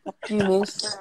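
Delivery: playful high-pitched TV character impression; Jonah Takalua. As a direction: quote the cheeky student through a laugh. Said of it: "as the, as Jonah Takalua though".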